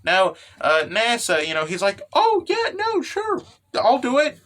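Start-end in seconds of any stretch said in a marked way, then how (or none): 2.1-4.4 high-pitched, obliging put-on voice; eager agreement. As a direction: squeak out a willing yes. Character agreeing to a favor.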